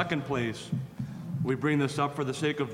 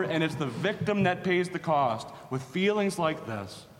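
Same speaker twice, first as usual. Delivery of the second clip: fervent preaching; 4 semitones higher than usual. Acted. Preacher to congregation about abused believers, fervent preaching. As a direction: preach it, building list by list.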